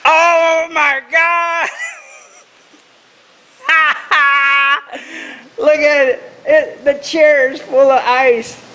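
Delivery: high, slow shocked cry. Real, not acted. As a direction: gasp at the frozen chair. Shocked at ice-covered chair.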